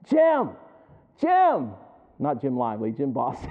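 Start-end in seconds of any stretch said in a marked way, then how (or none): 0.0-1.7 Jim! rising to a high, loud call. Retold or imitated. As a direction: shout Jim! louder each time to get his attention. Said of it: "I'll be like".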